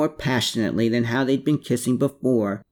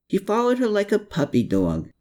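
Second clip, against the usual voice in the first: plain reading voice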